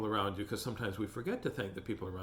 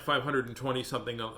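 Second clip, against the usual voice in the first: ordinary delivery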